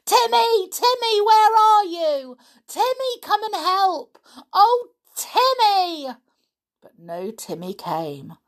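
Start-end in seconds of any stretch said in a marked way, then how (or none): 0.1-6.1 high, loud desperate scream; girl calling her dog while being tied up, loud and desperate. Acted. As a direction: scream out for help as they seize her. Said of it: "She screamed loudly".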